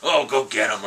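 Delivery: loud, hammed-up indignant moviegoer; mock outrage, punched out loud; mock riled up. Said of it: "might have been all riled up".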